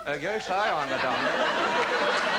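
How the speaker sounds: sheepish mock apology laced with laughter; mock meek. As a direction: play the innocent while taking the mickey. Driver answering cop with mock meekness.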